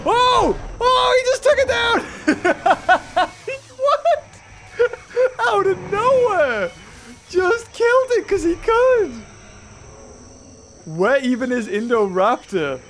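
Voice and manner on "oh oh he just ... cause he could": excited yelling. Real, not acted. T-Rex kill in game.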